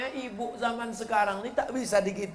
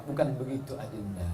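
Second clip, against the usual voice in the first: much lower, softer placating voice; soft low husband; 7 semitones lower, 7 dB quieter than usual. Retold or imitated. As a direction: soothe her gently and low. Husband soothing wife. (suami membujuk dengan suara rendah dan lembut)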